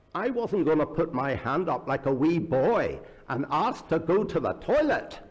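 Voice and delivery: put-on indignant retelling in an imperfect northern Irish accent. Governor explaining his pride to an interviewer, imitated northern Irish accent. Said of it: "I can't do the northern accent terribly well".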